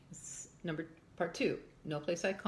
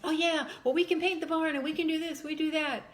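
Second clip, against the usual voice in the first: high, louder mock-enthusiastic planning; imitated eager chatter; 9 semitones higher, 4 dB louder than usual. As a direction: chime in like a keen group making plans. Acting out the townsfolk's busy planning.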